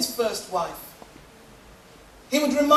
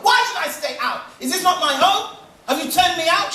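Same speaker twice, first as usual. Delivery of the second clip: indignant demand; 6 semitones higher, 4 dB louder than usual. Acted.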